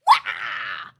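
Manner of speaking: sudden loud low yelp; startled yelp. Retold or imitated. Startled cry when a stranger touches her. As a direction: jump with a shocked cry.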